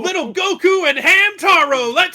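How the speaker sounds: high, loud hype cheer; excited shout. Real, not acted. Hyped nostalgia cheer.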